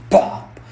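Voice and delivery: a loud, breathy pop with no voice; voiceless popping noise; mock pop sound effect. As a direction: spit the pop out like a toy springing up. Sound effect of clown springing.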